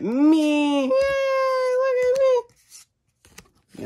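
very high-pitched, drawn-out squeal; piercing little squeaky cheer; squeaky character voice. Pretend. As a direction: squeal it out in a tiny high put-on voice. Squeals the same catchphrase.

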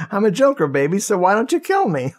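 high, campy villain audition voice; Joker. Acted. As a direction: pitch it up and ham up the villain. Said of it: "the audition like".

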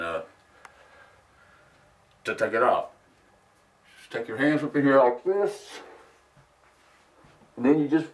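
muffled behind rubber mask; speaking through a face mask. Real, not acted. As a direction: mouth covered by full-head mask. Seen 3: Full-head silicone mask covers mouth until rolled off at 14.2.

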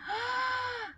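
high, soft drawn-in gasp; excited gasp. Real, not acted. Fan finding her photo cards, excited gasp. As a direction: gasp in delighted surprise.

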